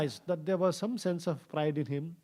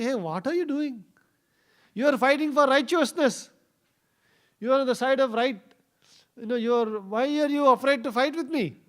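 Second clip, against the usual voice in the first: higher and louder, goading a scared opponent; taunting challenge; 7 semitones higher, 5 dB louder than usual. Retold or imitated. Vritrasura goads fearful Indra in battle, loud taunting challenge. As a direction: call him out to fight, raised voice.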